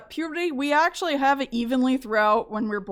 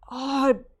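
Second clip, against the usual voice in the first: slow, drawn-out pained "oh, it burns"; mock pain; much slower than usual. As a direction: wince through the memory. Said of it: "recalling painful shot".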